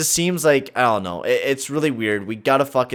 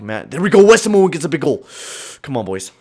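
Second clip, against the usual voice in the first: normal talking voice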